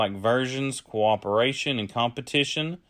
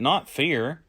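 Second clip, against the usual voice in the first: ordinary delivery, nothing marked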